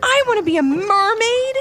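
put-on high-pitched thrilled little girl; eager, high kid voice; excited exclamation. A narrator's reading. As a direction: exclaim it like a delighted child. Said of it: "exclaimed Ivy".

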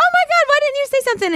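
high, fast excited gush. Retold or imitated. Reacting to a shy fan, fast high gush. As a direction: rattle it out, thrilled and pitched high. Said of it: "And I was like".

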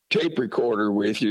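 normal talking voice

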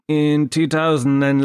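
usual speaking voice

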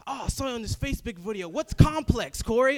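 high, loud excited mom voice; imitating mom. Mom calls about the video, excited high voice. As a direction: mimic your mom's eager questions. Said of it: "My mom hits me up".

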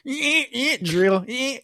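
high-pitched mock drill buzz; squealing "eh, eh" drill sounds; imitated drill noise. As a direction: mimic a power drill in short bursts.

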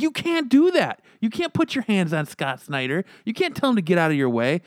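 panicked scolding. Retold or imitated.

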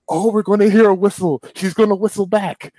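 breathless hope for the whistle; hopeful excitement. Retold or imitated. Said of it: "I was like".

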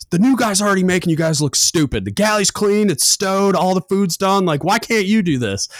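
high, loud mocking rebuke; mock scolding. Chief scolds crew.

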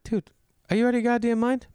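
high, mock-indignant scolding; hammed-up shock at the tip; mock outrage. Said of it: "And I was like".

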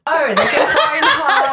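high, loud, drawn-out mock British; exaggerated British accent, pitched high and slowed down; playful British accent. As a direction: ham up a British accent, laughing. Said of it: "talk in a British accent".

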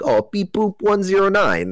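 hammed-up robot chatter; mock robot voice. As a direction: play a silly robot for a laugh. Talking to robot entity.

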